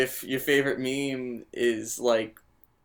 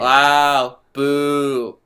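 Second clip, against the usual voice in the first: mock boo; 8 dB louder than usual. Mock booing the rule.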